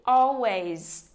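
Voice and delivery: ALways, stress on the first syllable. Shown as a demonstration. Teacher models "always" for students, stress on first syllable. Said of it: "những từ có hai âm tiết".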